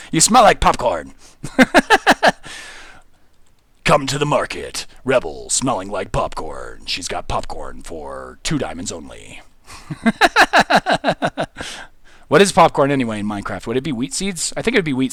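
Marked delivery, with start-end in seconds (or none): 3.8-9.4 low, slow movie trailer announcer; deep, drawn-out cinema trailer narration. Pretend. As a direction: sell it like a dramatic coming-soon ad. Mock ad for the market.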